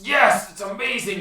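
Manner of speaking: loud, high, thrilled shout; cheering. Acted. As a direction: cheer in triumph. Player character cheers in dream.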